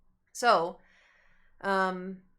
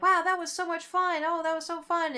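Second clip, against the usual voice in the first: much higher, gushing fake enthusiasm; mock excited friends; 10 semitones higher, 4 dB louder than usual. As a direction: imitate the carefree friends, bubbly and high. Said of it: "they're like".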